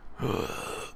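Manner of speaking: long, breathy gasp; gasp for air. Acted. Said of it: "I gasped hungrily for air".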